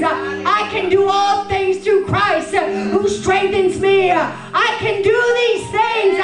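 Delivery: high, sung-out preaching cadence; full-force preaching. Acted. Preacher to the ladies, in a soaring sung cadence. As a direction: lift it into a singing proclamation.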